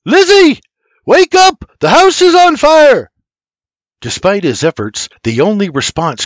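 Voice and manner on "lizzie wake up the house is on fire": loud, high shouts down a hall; urgent full-voice alarm yell; screaming. Acted. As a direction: scream to rouse sleepers to a fire. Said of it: "he screams".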